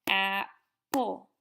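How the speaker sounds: apple split into two loud beats, ap-ple; teacher clapping out apple in beats; clapped syllables. Shown as a demonstration. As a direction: clap out apple syllable by syllable, loudly. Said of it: "You clap that word out".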